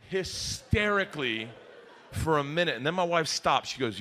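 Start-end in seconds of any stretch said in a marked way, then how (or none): none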